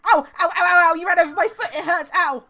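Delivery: high pitched ow ow ow; high pitched young voice. Retold or imitated. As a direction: squeal like a hurt little engine. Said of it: "pure high pitch me going".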